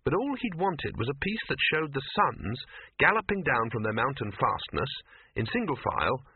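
ordinary delivery, nothing marked

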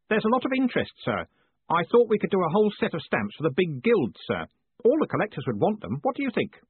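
high, keen junior clerk pitching an idea; eager young Stanley. Acted. Stanley pitches stamps to his boss, eager. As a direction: lift the voice, eager to please the boss.

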